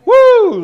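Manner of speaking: high, loud joyful woo; loud whoop. Real, not acted. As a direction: let out a big preacher whoop. Celebratory whoop to congregation.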